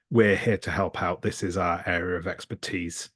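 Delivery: ordinary talk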